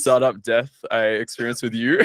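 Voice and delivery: ordinary delivery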